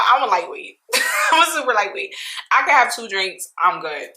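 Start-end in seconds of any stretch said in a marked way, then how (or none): 0.1-2.1 laughing through it; giggly confession. Real, not acted. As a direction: laugh at your own lightweight self. Laughing at herself.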